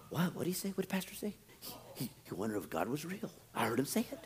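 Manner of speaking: hushed, scandalised whisper; gossiping whisper. Acted. Church member whispering gossip about the pastor, hushed and scandalised.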